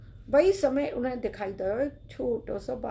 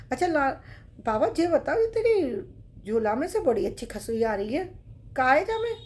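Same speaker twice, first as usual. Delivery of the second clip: put-on curious child; 3 semitones higher, 5 dB louder than usual. Boy voice curious.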